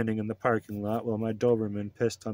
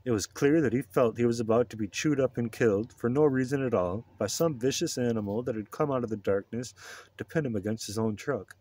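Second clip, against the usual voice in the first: usual speaking voice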